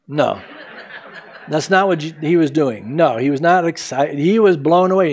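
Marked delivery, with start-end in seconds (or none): none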